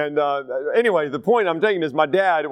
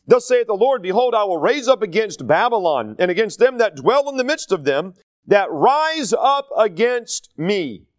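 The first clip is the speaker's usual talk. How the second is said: louder, solemn King James read; scripture reading; 4 semitones higher, 3 dB louder than usual. Acted. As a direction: proclaim the verse like from the pulpit. Reads scripture aloud.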